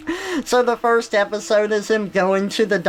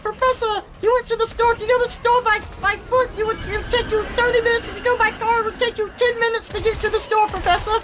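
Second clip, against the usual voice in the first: put-on much higher, fast know-it-all kid voice; obnoxious Luke; faster than usual. Luke lecturing the professor, high know-it-all whine. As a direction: rattle it off like an insufferable child. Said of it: "they make Luke just the most obnoxious thing".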